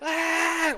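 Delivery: loud, high-pitched scream; frustrated scream. Pretend. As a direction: scream over the criticism in mock outrage. Mock rage at viewer's advice.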